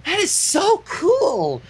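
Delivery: high, slow gushing delight; gushing Pinkie. Acted. Pinkie gushing over Twilight's magic. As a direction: gush with awe.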